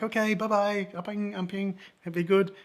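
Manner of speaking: high-pitched imitation of locals waving him off; friendly locals' farewell. Drinkers see the visitor off, high friendly goodbye. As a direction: send him off sweetly, pitched well up. Voices the group saying goodbye.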